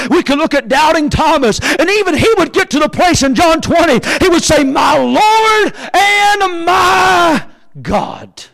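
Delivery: high preacher's shout with long-held words; full-force preaching. Acted. Preacher voices doubting Thomas's confession, full-force preaching. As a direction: stretch Thomas's confession into a ringing cry.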